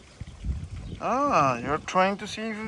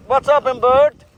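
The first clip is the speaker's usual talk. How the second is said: loud, higher call to a bird; 4 semitones higher, 6 dB louder than usual. Real, not acted. Owner calling to bird, loud raised call.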